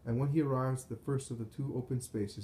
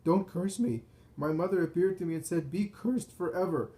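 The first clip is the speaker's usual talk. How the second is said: high, raised-voice frantic plea; shouting; 7 semitones higher, 5 dB louder than usual. A narrator's reading. Terrified Pharisee clinging to Nicodemus's neck, shouting. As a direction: cry out, pitch well up.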